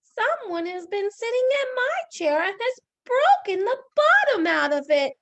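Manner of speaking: very high, loud little bear; high-pitched baby bear wail; Baby Bear's wee voice. Acted. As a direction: squeal it like a tiny upset bear cub. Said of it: "Baby Bear said in his wee little voice".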